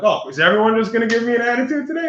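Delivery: high, loud mock indignation; exasperated complaint. Driver snapped at by second officer, high exasperated complaint. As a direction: complain as if everyone's against you.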